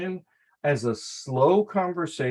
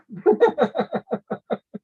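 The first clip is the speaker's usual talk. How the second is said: long, high chuckling run; real laugh; 8 semitones higher, 4 dB louder than usual. Real, not acted. Coach tells the storyteller to breathe, laughing at length. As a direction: keep laughing high till it fades.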